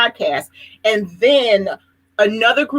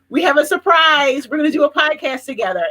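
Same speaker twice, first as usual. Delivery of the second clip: mock-announcing friends' news, higher pitched; excited surprise announcement; 6 semitones higher than usual. Two friends tell the host their surprise, excited imitation.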